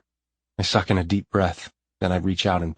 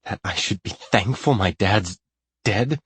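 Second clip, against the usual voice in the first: loud bitter taunt that eases off; heated bitter jab; sizzling anger; 4 semitones higher, 3 dB louder than usual. A narrator's reading. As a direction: sneer it at her in anger. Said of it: "Anger sizzles through me".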